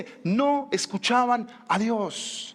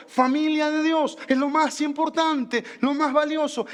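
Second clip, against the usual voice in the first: preaching emphatically, slower; sermon delivery; 5 semitones higher than usual. Acted. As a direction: proclaim it from the pulpit. Preaching to congregation. (predicando con énfasis)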